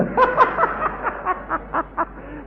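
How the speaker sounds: high, hearty burst of laughter. Real, not acted. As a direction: laugh it out freely. Preacher laughing aloud.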